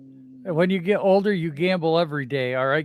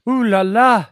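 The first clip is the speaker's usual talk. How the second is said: put-on French accent; 3 semitones higher, 8 dB louder than usual. Mock French banter.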